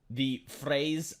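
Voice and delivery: ordinary delivery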